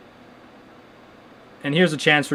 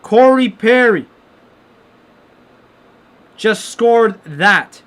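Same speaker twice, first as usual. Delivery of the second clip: higher, louder, slow drawn-out call of the scorer; mock goal call; 6 semitones higher, 5 dB louder, much slower than usual. Streamer mimics the TV announcer calling the goal, mock excited. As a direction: do the TV play-by-play man calling the goal, dragged out.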